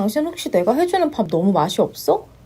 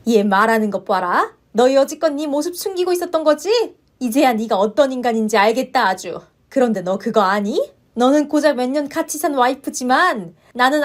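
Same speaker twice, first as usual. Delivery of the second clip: furious mother-in-law; 3 semitones higher than usual. Acted. Mother-in-law berates the wife over her son, furious. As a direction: tear into the daughter-in-law.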